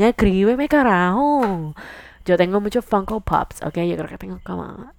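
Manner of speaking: normal talking voice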